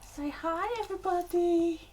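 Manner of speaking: high, sing-song voice for the dog; pet talk. Real, not acted. Greeting the dog in a high pet-talk voice.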